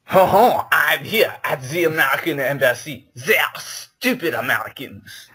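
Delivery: mock the Americans in a hammed-up French accent. Frenchman at the American Embassy, mock French accent. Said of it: "to do a French accent".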